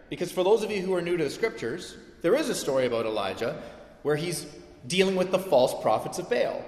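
ordinary talk, nothing marked

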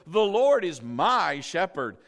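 slow and loud, MY stretched; confident declaration. Shown as a demonstration. Preacher explaining why he can say it, confident and emphatic. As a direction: proclaim it, leaning hard on "my". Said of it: "I can confidently say".

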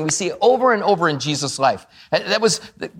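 ordinary delivery

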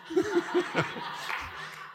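high, quiet chuckle; hushed, amused laughter; soft laugh. Real, not acted. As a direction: laugh softly at your own story. Laughs at own story.

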